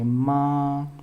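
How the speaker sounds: माँ held long on a level hum; माँ with a long, chanted vowel; halant pronunciation. Shown as a demonstration. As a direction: draw out माँ as one sustained tone. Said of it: "उसको इसी तरह से बोलेंगे".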